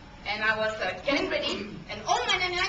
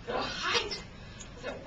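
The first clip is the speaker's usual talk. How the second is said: normal talking voice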